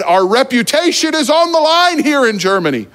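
high-pitched, exasperated dad imitation; retold parental scolding. Dad to son after the restaurant fire, exasperated.

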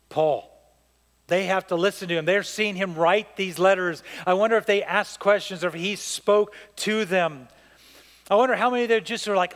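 ordinary talk

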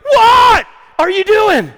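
frantic high shout at the TV; yelling at TV. Retold or imitated. Husband yelling at the TV during the Duke game, frantic shout. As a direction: scream at the screen as the game cuts out. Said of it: "and I go".

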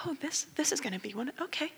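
fast, hushed aside; quiet whispered anticipation. Retold or imitated. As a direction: murmur it to yourself.

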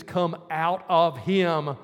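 slow, low, firm command; slow stern command. A narrator's reading. Jesus ordering the spirit out, slow and firm.

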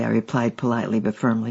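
plain reading voice

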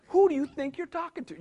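mild mock confrontation, pitched high; indignant dad. Father to man who cussed his son, indignant. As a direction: challenge him like an affronted father, half in play.

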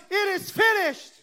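high-pitched retold shout; loud shout. Preacher voicing Jesus' last shout on the cross, shouting. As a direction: cry it out high, as a final declaration. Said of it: "He shouted in a loud voice".

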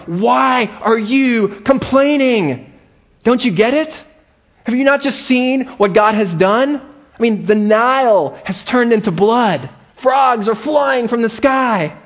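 high, exasperated scolding; mock yelling at the Israelites. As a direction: yell at them like a frustrated parent. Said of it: "I just start yelling at the Israelites".

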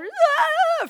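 loud, high, drawn-out wail; hammed-up panicked "aah"; freaking-out scream. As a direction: cry out high and long, as if losing it. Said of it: "freaking out".

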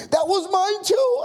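very high, excited shout; overjoyed outburst. Acted. Traveler finding his own denomination, shouting excitedly. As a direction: squeal it out in sheer delight.

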